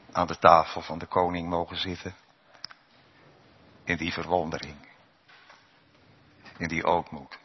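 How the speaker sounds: usual speaking voice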